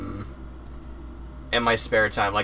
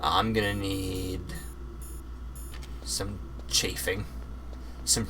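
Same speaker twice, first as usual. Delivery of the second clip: slow, lightly lowered Snake impression; 3 semitones lower, 8 dB quieter, slower than usual. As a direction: drop into a slow soldier Snake voice. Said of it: "I do the Snake voice".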